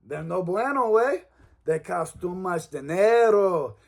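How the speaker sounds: hammed-up Mexican accent sales pitch. Host voicing a joke sponsor ad for a gym, Mexican accent. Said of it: "I don't know where my accent went".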